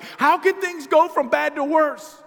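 high, loud, slow wail of disbelief; mock anguished wail; exasperated cry. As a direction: cry it out like a man just fired. Exasperated fired worker.

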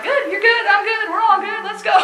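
high, loud go-go-go cheer; an overly chipper brush-off; brisk upbeat hustle. Retold or imitated. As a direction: rush everyone along breezily. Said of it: "I was really gouged".

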